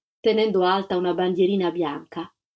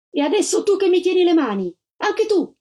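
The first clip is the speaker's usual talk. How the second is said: much higher, loud accusing cry; shouting maid; 10 semitones higher, 4 dB louder than usual. A narrator's reading. The maid shouts at the agent gripping her hands. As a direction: fling the words at the agent holding her. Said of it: "fece la cameriera".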